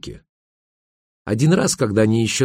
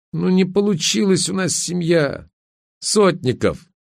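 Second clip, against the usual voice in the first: high-pitched, mildly annoyed; irritated; 9 semitones higher than usual. A narrator's reading. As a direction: spell it out with tired impatience. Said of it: "раздражённо сказала Верка". (раздражённо)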